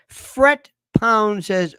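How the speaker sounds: normal talking voice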